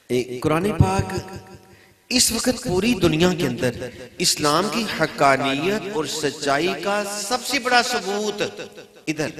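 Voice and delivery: loud, high rhetorical proclamation; emphatic, high-pitched sermon delivery. Real, not acted. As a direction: ring it out to the congregation. Preaches at raised force.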